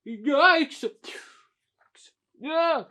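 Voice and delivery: high, loud pretend yelp; startled yelp. Character startled before running away, high loud yelp. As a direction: yelp in hammed-up alarm.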